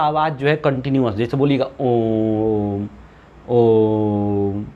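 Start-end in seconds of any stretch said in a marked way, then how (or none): 1.8-4.6 ओम held long and low, the vowel sustained; a slow, lengthened ओम like a chant; long drawn-out Om. Shown as a demonstration. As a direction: chant ओम slowly, stretching the sound. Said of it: "तो इसको बहुत लंबा बोला जाता है".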